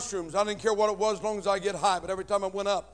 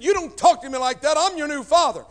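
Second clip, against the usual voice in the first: higher, much louder scolding; stepfather's yell; 7 semitones higher, 10 dB louder than usual. Retold or imitated. Stepfather yelling at stepson, loud. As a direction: lay down the law, loud.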